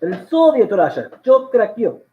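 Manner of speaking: loud mock Russian accent; hammed-up Russian accent with swooping pitch. Cracking a Soviet Russia joke, Russian accent.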